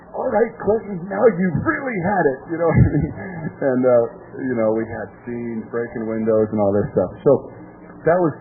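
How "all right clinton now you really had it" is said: higher, softer, mock menacing; dripping wet mother. Imitating soaked mom warning her husband, mock threatening.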